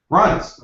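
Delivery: "Runs" alone, pitched high for emphasis; stress on Runs. Real, not acted. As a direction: punch "Runs" as a high one-word sentence. Repeats the word for emphasis.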